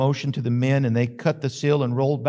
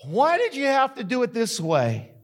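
Martha's plea, pitched higher; higher voice of a wounded, questioning Martha; imagined Martha; 6 semitones higher than usual. Pretend. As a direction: ask it as a hurt sister. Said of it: "Maybe she said something like this".

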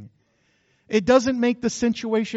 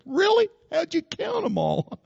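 high, teasing disbelief, slowing on the count; mock the husband's exaggeration, pitched up high; sarcastic wife; 9 semitones higher than usual. Wife teasing husband about office woes, sarcastic and high. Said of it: "She said".

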